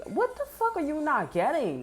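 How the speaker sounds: high-pitched exasperated question, volume held back. Retold or imitated. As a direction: replay your incredulous frustration. Quotes self confronting someone.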